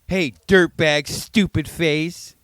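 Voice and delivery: high, slow mock-angry taunt; imitating angry kid. Little kid insulting a crow, mock angry. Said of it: "He was angry at that bird".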